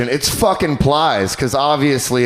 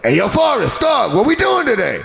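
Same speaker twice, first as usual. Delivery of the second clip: higher rough [name] greeting; 9 semitones higher than usual. Retold or imitated. [name] greeting Forrest Gump at training, higher. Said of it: "he's like".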